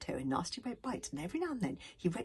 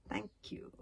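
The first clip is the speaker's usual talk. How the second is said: whispered sneer of thanks; 3 semitones higher, 8 dB quieter than usual. A narrator's reading. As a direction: say thanks with contempt, under the breath. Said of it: "sneered Alvin".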